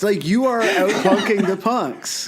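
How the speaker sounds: laughing. Real, not acted. Still laughing at the joke.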